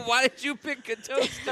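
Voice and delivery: high, loud, drawn out through laughter; helpless laughing exclamation. Real, not acted. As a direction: crack up while asking in disbelief. Retelling absurd game, cracking up.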